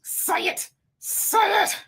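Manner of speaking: high, strained little voice, half whispered; mouth urging her on. Acted. Streamer's own mouth tempts her to speak, high squeezed urging. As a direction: egg yourself on in a tiny squeezed voice. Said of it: "my mouth is like".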